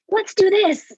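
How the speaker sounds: high and loud pep call; mock rallying cry. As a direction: pump them up like a coach. Parent rallying the kid.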